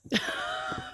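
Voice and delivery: high nervous squeal of a laugh; startled squealing laugh. Real, not acted. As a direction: let out a spooked squeal that turns into a laugh. Spider web in her face.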